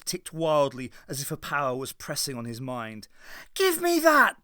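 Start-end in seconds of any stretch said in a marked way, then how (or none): 3.5-4.4 high, loud shouted demand; snatch at it with your voice; angry demand. A narrator's reading. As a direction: Ace shouting at Vale to hand it over. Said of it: "demanded Ace".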